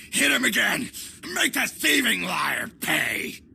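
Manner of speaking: high, angry shout; angry order. Acted. Villain orders a beating in game.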